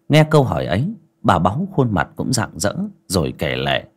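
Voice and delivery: plain reading voice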